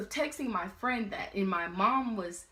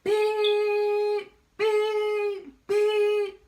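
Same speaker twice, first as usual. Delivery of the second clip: loud, high, long beeps like a horn; imitated car horn; 13 semitones higher, 9 dB louder, much slower than usual. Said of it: "she blows the horn so loud".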